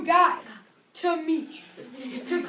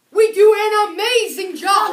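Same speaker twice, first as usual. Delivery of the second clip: building hype; 4 semitones higher, 13 dB louder than usual. Acted. Director hyping the program.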